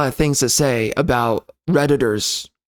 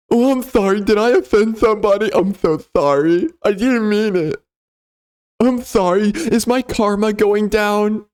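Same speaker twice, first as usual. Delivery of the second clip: mock apology; 5 semitones higher than usual. Mocking a commenter's fake apology.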